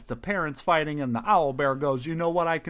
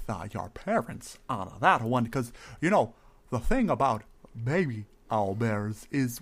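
normal talking voice

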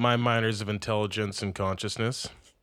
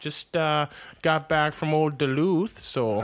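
louder, higher folksy Minnesotan; Minnesota accent; 5 semitones higher, 4 dB louder than usual. Pretend. Host playing a Minnesotan back from Duluth, thick accent. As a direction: chat about Duluth like a local. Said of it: "where did you get that accent from".

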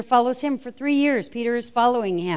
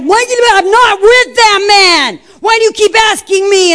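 high, loud, furious shout; angry shout; 9 semitones higher, 10 dB louder than usual. Retold or imitated. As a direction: yell it like he's cursing them off. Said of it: "he called down curses".

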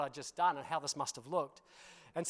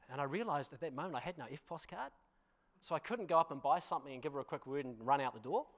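ordinary talk, nothing marked

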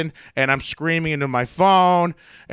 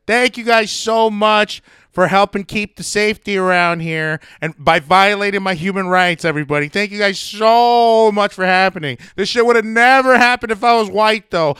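raised, mocking public outburst; making a scene; 4 semitones higher than usual. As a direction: shout fake thanks to the whole room. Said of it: "making a scene".

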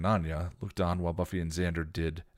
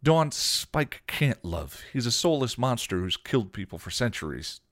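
countering; 6 semitones higher, 5 dB louder than usual. A narrator's reading. Xander counters Dawn about Spike, firm. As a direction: lay it down word by word. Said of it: "Xander countered".

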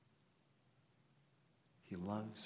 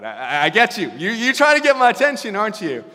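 mock exasperated outcry; 11 semitones higher, 24 dB louder than usual. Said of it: "you're just like".

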